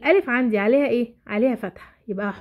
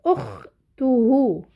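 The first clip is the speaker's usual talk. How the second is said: "اخته" as long, separate okh-tu-hu; sounded out slowly; much slower than usual. Shown as a demonstration. Teacher introduces the next tricky word, "اخته" sounded out slowly. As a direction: draw out every syllable of "اخته" very slowly. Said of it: "بحلل صوتي".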